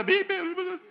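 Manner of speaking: high, loud bleeped yelling; imitated screaming. Girl screaming at her friend, imitated bleeped yelling. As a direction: yell the beeped swearing. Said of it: "She's screaming back at her".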